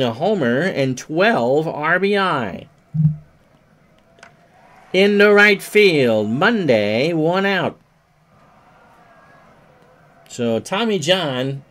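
baseball announcer calling the action; play-by-play. Acted. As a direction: call each pitch like live radio. Live play-by-play of baseball game.

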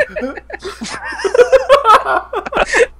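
long, very high laughing fit; hysterical laughter. Real, not acted. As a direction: dissolve into high-pitched laughter. Laughing at the drawing.

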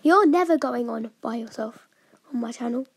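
ordinary talk, nothing marked

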